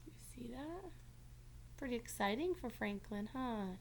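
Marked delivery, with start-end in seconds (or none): none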